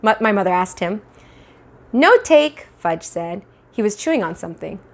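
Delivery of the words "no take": put-on high, loud little-kid voice; toddler voice. Fudge refusing mother, high stubborn toddler voice. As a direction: blurt it like a stubborn two-year-old.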